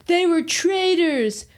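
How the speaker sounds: high, slow cry of They were traitors; crowd's outraged cry. Retold or imitated. Citizen to the crowd, mock outraged cry.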